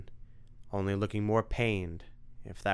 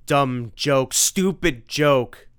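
loud, higher, slow rant; freaking-out vampire; 5 semitones higher, 11 dB louder, much slower than usual. Acted. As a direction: rage as he unravels.

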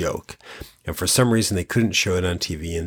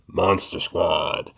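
low, slow trailer announcer voice; movie announcer; 3 semitones lower, much slower than usual. Retold or imitated. Quoting the trailer, deep announcer voice. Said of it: "so the announcer can say".

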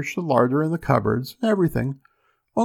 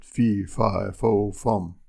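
lightly voiced ogre, pitched lower; 4 semitones lower, much slower than usual. A narrator's reading. Ogre announcing himself as he comes in, low chant.

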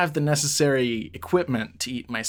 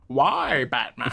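mock-villain lament; supervillain impression; slower than usual. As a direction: plead theatrically as a masked villain. Joking as Bane to Batman.

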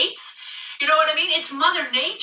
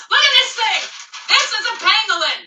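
outraged exclamation; 8 semitones higher, 4 dB louder than usual. Acted. Showing pangolin picture, outraged.

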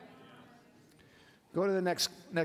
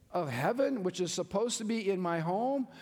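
usual speaking voice

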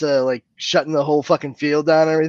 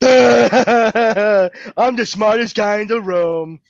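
slow, drawn-out dopey boast; mock dimwit; 2 semitones higher, 3 dB louder, much slower than usual. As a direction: drag it out like a proud fool. Mocks character with dopey laugh.

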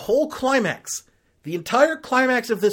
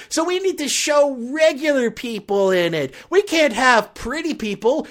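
higher, loud mocking impression; mock Amy Schumer; 8 semitones higher, 6 dB louder than usual. Mocking Amy Schumer stealing the model gig, loud and smug. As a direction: ham up her smug excuse. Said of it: "and says".

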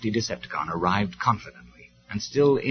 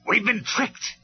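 storming; 12 semitones higher, slower than usual. Acted. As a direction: rage at being tricked. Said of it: "he stormed".

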